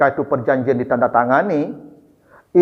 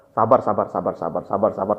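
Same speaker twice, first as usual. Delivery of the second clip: soothing, fading; 9 semitones lower, 6 dB quieter than usual. Retold or imitated. Umar quietly soothing Abu Jandal while offering his sword, fading murmur. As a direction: lower soothing words, fading to a near-whisper.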